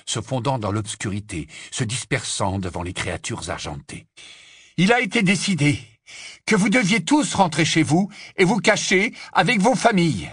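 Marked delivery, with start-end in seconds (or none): 4.8-10.3 raised, higher-pitched proclamation; public announcement. Acted. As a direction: announce it firmly to a whole crowd. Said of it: "annonça Harry au sorcier né moldu". (annonce proclamée, voix haute)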